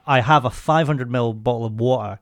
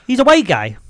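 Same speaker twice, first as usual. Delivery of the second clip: 1 surprised people; 11 semitones higher, 6 dB louder than usual. Retold or imitated. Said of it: "People go".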